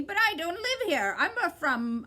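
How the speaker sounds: lightly voiced mocking bird; a hint of a squawky bird taunting. A narrator's reading. Bird teasing Milo, lightly voiced.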